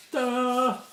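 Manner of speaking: high, bright ta-da flourish; excited reveal. Real, not acted. Showing the new book to viewers, a high excited ta-da. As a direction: unveil it like a magic trick.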